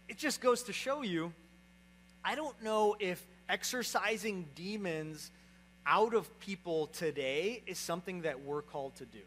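normal talking voice

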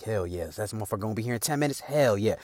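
low, soft gleeful murmur; hushed gloating. Retold or imitated. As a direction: gloat under your breath. Brothers gloating quietly.

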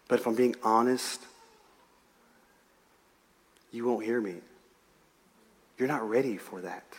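soft, low voice of a loving father; tender, loving. Retold or imitated. Jesus speaking to a son or daughter, tender and hushed. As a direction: speak low and gently, leaving room between phrases.

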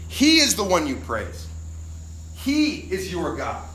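solemn scripture proclamation; scripture reading. Acted. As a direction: proclaim the verses with weight. Preaching scripture.

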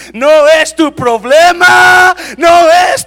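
high, shouted sermon delivery; full-force preaching. Acted. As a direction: thunder out God's reassurance to an embattled believer. (prédica a gritos, con voz aguda y potente)

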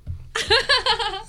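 a high giggling squeal; high squealing laugh. Real, not acted. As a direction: let out a giddy high squeal. Laughing at his own boast.

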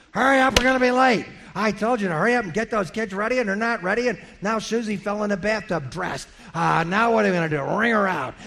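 put-on rushing the kids out the door, bickering; mock family quarrel. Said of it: "you're yelling at each other".

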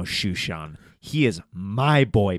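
ordinary talk, nothing marked

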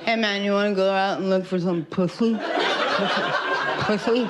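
fast lisping "pussy" as "puthy". Shown as a demonstration. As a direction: lisp through "Pussy" with dead eyes. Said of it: "a deadness in the eyes, a lisp".

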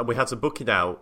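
normal talking voice

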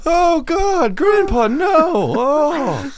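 high, loud wailing cry of grief; wailing mourner. Pretend. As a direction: wail over Grandpa's body. Mourner at the funeral.

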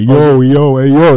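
loud New York "yo" in a Rocky-movie style; mocking New York accent from the movies. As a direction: imitate Rocky's New York street call. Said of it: "That's more like a New York thing".

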